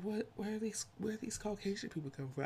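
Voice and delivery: high, fast, puzzled wondering; mock surprise. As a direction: ask it like you can't believe your eyes. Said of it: "I'd be like".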